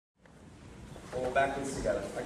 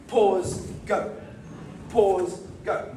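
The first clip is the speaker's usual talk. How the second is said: louder Pause, then a gap before go; stop and go; 3 semitones higher, 3 dB louder, much slower than usual. Shown as a demonstration. Teacher showing the faulty timing, stop and go. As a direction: hold back after Pause, then go. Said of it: "without a pause".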